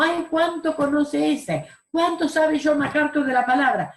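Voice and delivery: high-pitched mock admiration; admiring exclamation. Churchgoers marvelling at a scholar's knowledge, high admiring exclamation.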